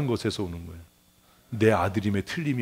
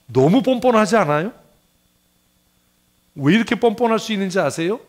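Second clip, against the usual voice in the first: loud, high rhetorical questions; emphatic preaching; 10 semitones higher, 8 dB louder than usual. Real, not acted. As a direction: fire the questions at the congregation. Preaches at full force. (크고 높은 목소리로 청중에게 던지는 물음)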